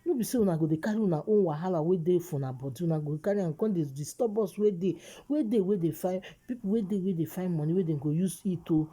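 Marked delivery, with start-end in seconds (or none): none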